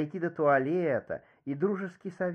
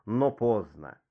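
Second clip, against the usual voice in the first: ordinary delivery, nothing marked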